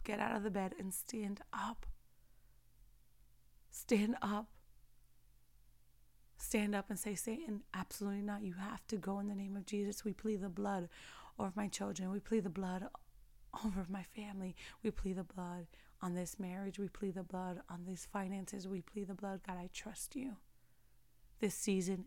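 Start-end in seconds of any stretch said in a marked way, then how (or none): 0.0-4.5 whispered urging. Real, not acted. Whispered exhortation to rise. 6.4-20.4 soft, low whispered intercession; quiet whispered prayer; hushed prayer. Real, not acted.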